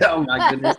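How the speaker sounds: a high, laughing mock-Southern "Oh, my goodness"; imitating a Southern accent. Teasing Miss Lee by parroting her Southern exclamation. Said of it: "When she said".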